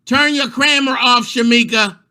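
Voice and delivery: high, loud yell from the background; parent yelling. Retold or imitated. Parent in the background yelling at her child, loud. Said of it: "the parents be in the background".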